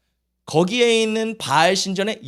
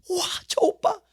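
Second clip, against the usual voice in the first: high, softer awestruck swoon; mock infatuation; 13 semitones higher, 5 dB quieter than usual. Acting out Israelites seeing pretty foreign women, smitten. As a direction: swoon over someone pretty.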